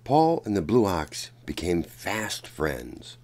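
ordinary delivery, nothing marked

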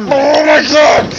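slow, loud exasperated shout; frustrated shout. Real, not acted. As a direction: yell it at the screen in frustration. Gamer shouting at failure.

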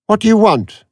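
a testy supercomputer waking up; irritable machine. A narrator's reading. Aurak snaps at Avon on waking, high and testy. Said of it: "it said irritably".

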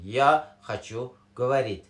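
Russian phrase "یا خچو گوارید" said slowly and clearly; slow teaching demo. Shown as a demonstration.

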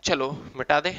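ordinary talk

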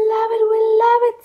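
high, fast, eager cheer; excited kids. Acted. Children begging the babysitter, high and fast. Kids beg for more rhymes.

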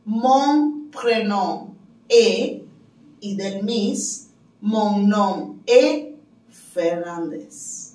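very slow French with spaced words; drawn-out, careful French example; slow language-teacher model. Shown as a demonstration. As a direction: say it slowly for learners to copy. Models French answers for learners.

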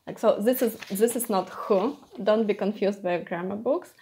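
ordinary delivery

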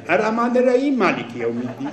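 الرحمن الرحیم with the ha and ain sounds replaced by glottal stops, said fast; wrong Arabic sounds. Shown as a demonstration. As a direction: recite مالک یوم الدین carelessly, dropping ain and ha, mocking.

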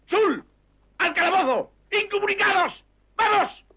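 very high shouted orders; drill sergeant. Acted. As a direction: bellow the orders.

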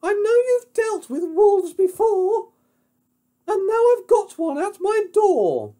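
very high, loud piggy falsetto; alarmed pig. Acted. Pig reports a wolf at the door, squealing high. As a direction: plead urgently in a squeaky pig voice. Pig explains his danger.

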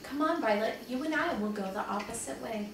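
usual speaking voice

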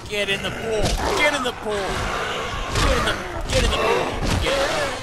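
loud, high-pitched repeated command; excited shouting. Real, not acted. Player driving zombies into the pool, excited shouting. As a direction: yell it urgently, over and over.

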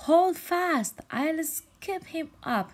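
high, loud, slow bold declaration; declaring. Acted. Pelican declares to jellyfish he will rescue, loud and high. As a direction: announce it like a hero bird. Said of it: "he declared".

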